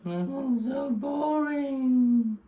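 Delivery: very high, slow sing-song moan; mock bored whine. Waiting on the pot to boil, a hammed-up high bored whine.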